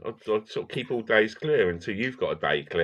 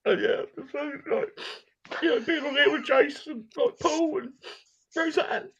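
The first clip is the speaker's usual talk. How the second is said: mock emotional. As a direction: play it overly emotional and high. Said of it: "I'm so emotional".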